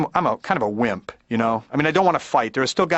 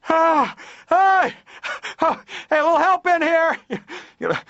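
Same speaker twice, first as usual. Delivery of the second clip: mock panic; 13 semitones higher, slower than usual. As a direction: squeal for help like a trapped patient. Stuck in blood pressure cuff.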